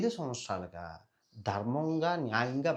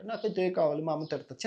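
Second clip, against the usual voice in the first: put-on high, tearful little girl's whine; a small child pleading as if near tears; crying little girl; 7 semitones higher than usual. Little girl cries to Karna over spilt ghee, high whining plea. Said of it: "చిన్నపిల్ల కదా, అలాగే ఏడుస్తుంది".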